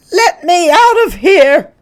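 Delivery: high, very loud panicked shriek. Acted. Mrs Hipple shrieks to escape the house, loud and panicked. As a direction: scream to be let out. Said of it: "Missus Hipple shrieked".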